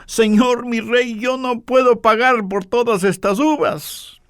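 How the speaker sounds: high, alarmed protest; frightened. Acted. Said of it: "Ella, asustada".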